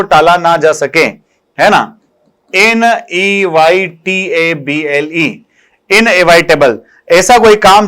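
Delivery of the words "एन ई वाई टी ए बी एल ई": very slow letter-by-letter spelling. Shown as a demonstration. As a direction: spell it out slowly, one letter at a time. Teacher spells out a word. (धीरे-धीरे एक-एक अक्षर बोलते हुए)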